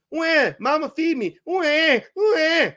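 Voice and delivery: put-on high, slow whining baby wail, begging mama; mock baby crying. Mocking rappers as helpless babies, high baby wail. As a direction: cry for food like a hungry baby. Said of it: "No, babies just wah".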